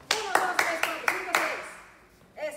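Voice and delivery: loud, rapid counting shout; fast shouted count. Shown as a demonstration. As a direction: bark the numbers to speed them up. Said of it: "Más rápido".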